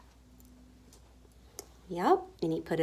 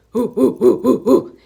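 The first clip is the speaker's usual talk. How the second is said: loud, rhythmic ape hooting; punchy gorilla calls; gorilla hoots; 11 dB louder than usual. A narrator's reading. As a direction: hoot like a gorilla. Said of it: "The gorilla".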